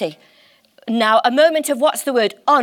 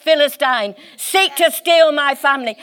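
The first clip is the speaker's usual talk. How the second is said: indignant preacher, hammering each "how dare"; rising outrage, pitched up at the peaks; worked-up indignation; 3 semitones higher than usual. Real, not acted. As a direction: preach it like a righteous protest. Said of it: "I'm getting worked up".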